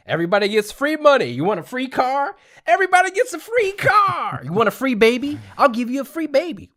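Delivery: mock politician building to a shout; campaign rally pitch. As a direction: hype the crowd with giveaways.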